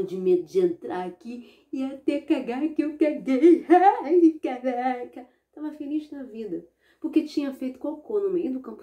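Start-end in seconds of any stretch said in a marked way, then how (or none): 1.7-5.3 louder, high gleeful laugh on it; laughing brag. Acted. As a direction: crack up at your own mischief. Boy laughs at his feat. (risada alta e vitoriosa)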